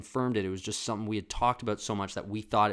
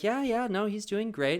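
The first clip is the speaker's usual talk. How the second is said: high, louder chirpy proud-mom voice; 12 semitones higher, 4 dB louder than usual. Retold or imitated. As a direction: sing out the good news brightly. The friend's mother, voiced.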